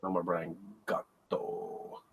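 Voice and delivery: hushed, drawn-out mock mourning; mock lament. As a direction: trail it out softly like a pretend farewell. Mock lament after sad news.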